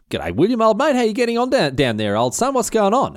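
high, chummy hail; breezy mock greeting. Agnes pops her head over the ramparts, breezy greeting. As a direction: call down like greeting an old mate.

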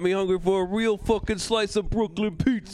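joking in a mock Brooklyn voice; New York accent. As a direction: brag about pizza like a Brooklyn local. Joining the New York bit.

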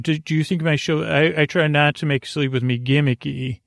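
ordinary delivery

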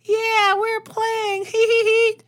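put-on very high, giggly child voices; giggly kids. Imagined kids cheer while playing, very high and giggly. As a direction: squeal like happy playground kids. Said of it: "they're all like".

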